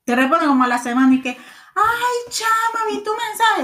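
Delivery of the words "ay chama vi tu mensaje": much higher, sing-song fake innocence; fake sweet friend. Imitating a friend who replies a week late, high and airy. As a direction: play the airy friend who ignored you.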